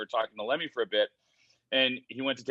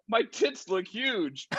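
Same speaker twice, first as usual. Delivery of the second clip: slow, higher, drawn-out lament; mock dismay; 8 semitones higher, much slower than usual. Looking at his photo with [name], slow higher mock lament. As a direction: stretch it out in mock horror. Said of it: "And I go".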